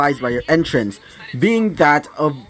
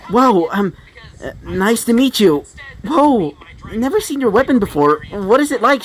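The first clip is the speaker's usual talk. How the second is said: surprised, higher-pitched eager gush; Ruby; 7 semitones higher than usual. Acted. Ruby asking the summon about the weapon, surprised and excited. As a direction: burst out in starstruck wonder. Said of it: "As she would be surprised, saying".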